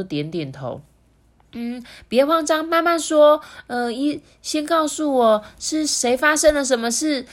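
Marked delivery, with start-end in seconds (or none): 1.6-7.3 high, emphatic auntie voice; reassuring auntie. A narrator's reading. Doctor auntie coaxes Little Lemon to explain, reassuring. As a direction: urge a flustered child to take it one step at a time.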